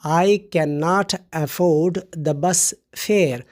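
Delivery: "I cannot afford the bus fare" drawn out very slowly; slow example sentence. Shown as a demonstration. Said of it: "اس طرح آپ استعمال کر سکتے ہیں". ("I cannot afford the bus fare" کو بہت آہستہ، ٹھہر ٹھہر کر پڑھیں)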